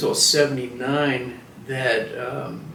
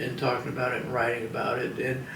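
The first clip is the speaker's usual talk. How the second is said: ordinary delivery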